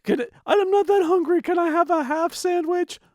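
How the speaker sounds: very high, loud customer voice; imitated customer. Customer asking for half a sandwich, high-pitched. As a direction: plead in a high-pitched mock voice. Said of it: "And they would say".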